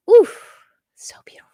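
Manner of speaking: sharp oof, then whispered awe; awed. Real, not acted. Admiring ice blue unicorns, awed whisper.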